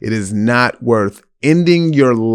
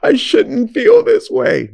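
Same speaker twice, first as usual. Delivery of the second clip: high, fretful inner voice; pitched way up like a worried conscience; mock guilty inner voice; 13 semitones higher than usual. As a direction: squeak out the guilty thoughts. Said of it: "you're gonna think about it like".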